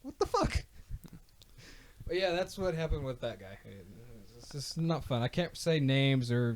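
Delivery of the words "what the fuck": high, shocked squeal of disbelief; stunned disbelief. Retold or imitated. Retelling his reaction to the guy's admission, high shocked disbelief. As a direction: blurt it out in disbelief, voice jumping way up. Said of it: "I was like".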